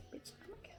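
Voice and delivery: unvoiced whisper. Shown as a demonstration. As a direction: whisper it in delight. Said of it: "trying the phrase different ways".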